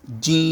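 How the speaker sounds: جیم with its long ee held very slowly on one note; pressed, stretched جیم. Shown as a demonstration. Teacher showing the madd, جیم stretched long and level. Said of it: "مد کے ساتھ کھینچ کر پڑھیں گے".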